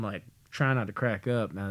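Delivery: ordinary delivery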